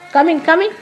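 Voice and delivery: inviting call to a newcomer. Real, not acted. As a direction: beckon across the hall. Calling someone forward.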